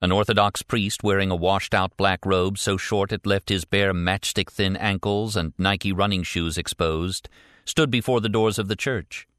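ordinary delivery

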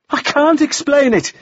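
very high, desperate outburst; distraught protest. A narrator's reading. Woman pressed to explain her collapse, distraught. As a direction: cry it out high, at a loss.

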